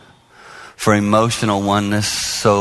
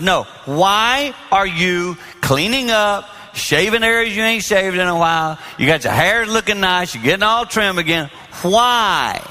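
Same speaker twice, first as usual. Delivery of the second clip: probing; 7 semitones higher than usual. Retold or imitated. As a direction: needle him about his new grooming. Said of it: "I said".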